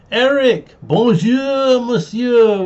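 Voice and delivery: bright, loud bonjour monsieur; cheerful French greeting. Real, not acted.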